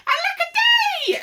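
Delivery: very high, loud lament; hammed-up storybook wail; wailing cry. A narrator's reading. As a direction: cry out in melodramatic falsetto woe. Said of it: "And he cried".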